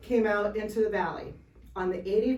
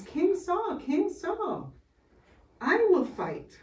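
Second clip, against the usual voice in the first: put-on eager little boy; 10 semitones higher, slower than usual. Little David begging the king.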